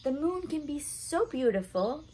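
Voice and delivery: ordinary delivery, nothing marked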